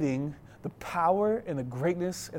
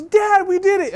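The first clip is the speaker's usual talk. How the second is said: imitated excited kid; 8 dB louder than usual. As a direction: mimic a son yelling gleefully to dad. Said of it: "Zion is so excited".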